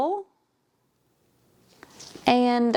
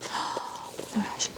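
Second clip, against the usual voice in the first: slow, soft whisper of amazement; awed whisper; 11 dB quieter, slower than usual. Real, not acted. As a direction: breathe it out, stunned by the cover. Awed at a pretty book.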